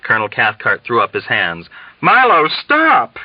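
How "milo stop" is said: high, slow anguished cry of stop; a pleading wail, close to tears; desperate, near-tearful outcry. A narrator's reading. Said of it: "he cried, almost in tears".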